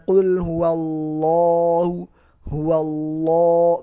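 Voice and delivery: huwallahu with a heavy, thick lam, slow and loud. Shown as a demonstration. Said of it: "maka lamnya dibaca tebel". (lam pada huwallahu dibaca tebal)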